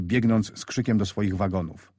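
ordinary delivery, nothing marked

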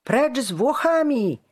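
high, loud hostile cry; angry protest yell; calling out. A narrator's reading. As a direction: shout it from the crowd. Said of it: "zawołał ktoś w tłumie".

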